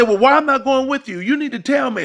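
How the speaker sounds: high, loud pleading woman's voice; boyfriend's partner demanding why he leaves, high and pleading; upset woman. Retold or imitated.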